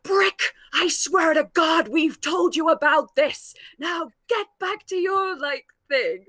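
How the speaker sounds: a hint of a fed-up policeman; scolding officer. Policeman scolding Brick on the ship, lightly put-on stern voice.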